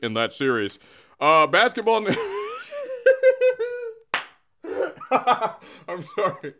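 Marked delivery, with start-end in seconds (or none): 2.1-3.9 high-pitched giggling fit; helpless high laugh; uncontrollable laughter. Real, not acted. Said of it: "Let me do this without laughing". 5.1-6.5 helplessly laughing, drawn out slowly; words stretched out by laughter; laughing apology. Real, not acted. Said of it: "Let me do this without laughing".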